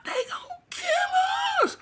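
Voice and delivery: very high, slow, crying scream; terrified scream. Retold or imitated. Girlfriend screaming as bullets hit the car, crying scream. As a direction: wail it in sheer terror. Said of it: "this woman let out a scream".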